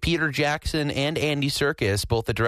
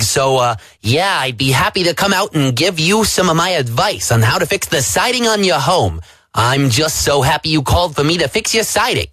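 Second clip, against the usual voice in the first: friendly siding guy; 2 semitones higher, 9 dB louder than usual. Shown as a demonstration. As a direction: sell siding as an eager, happy-to-help handyman. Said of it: "That's voice number two".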